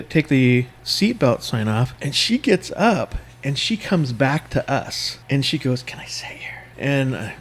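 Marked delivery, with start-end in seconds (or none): 5.8-6.6 soft, voiceless imitated whisper; whispered request. Woman asking two passengers for a seat, whispered. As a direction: whisper it as a discreet ask. Woman asks to join them.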